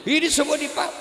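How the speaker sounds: normal talking voice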